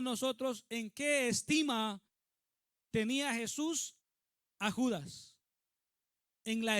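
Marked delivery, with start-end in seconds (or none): none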